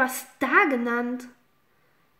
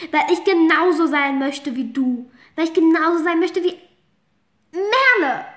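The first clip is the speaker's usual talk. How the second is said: tearful outburst; 2 semitones higher, 6 dB louder than usual. Acted. Outburst of confession.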